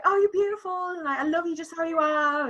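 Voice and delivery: Josh. Retold or imitated. Fiancé comforting her after the shower, imitated adoring reassurance. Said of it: "And Josh is like".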